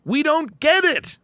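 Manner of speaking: high, slow baffled line; high drawn-out confusion; mock torturer. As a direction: stretch it out in disbelief.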